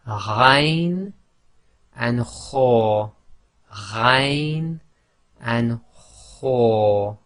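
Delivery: ghain and kho said from the top of the throat with friction; a raspy ghain, then a scraped kho. Shown as a demonstration. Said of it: "top of the throat".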